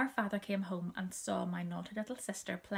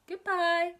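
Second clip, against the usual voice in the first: high, louder call of goodbye; 10 semitones higher, 8 dB louder than usual. A narrator's reading. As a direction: call it out down the road. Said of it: "and called".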